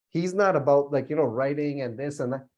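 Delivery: usual speaking voice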